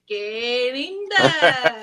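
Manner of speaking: lightly put-on Spanish lilt.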